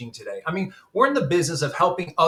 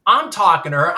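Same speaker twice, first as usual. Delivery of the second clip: ordinary talk, nothing marked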